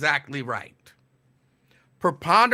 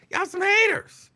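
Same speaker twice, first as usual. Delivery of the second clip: high-pitched mock complaint; mock whine; 14 semitones higher than usual. Host teasing callers who hate on his accent, high mock whine. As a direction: squeal it in playful protest.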